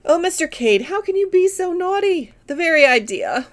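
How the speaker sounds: high, arch and playful Miss Taylor; coy teasing, voice lifted high; coquettish. A narrator's reading. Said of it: "cried Miss Taylor coquettishly".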